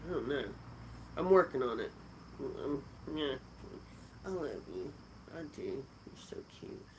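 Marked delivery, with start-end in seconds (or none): 4.2-6.8 whispered coo. Real, not acted. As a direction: whisper sweetly to the pet. Cooing to her dog.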